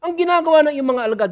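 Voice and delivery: high, loud, hurried protest; angry complaint. Acted. Pharisees angrily complaining to Jesus about his disciples. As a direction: snap it at Jesus in indignation. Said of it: "Galit na sila kay Jesus".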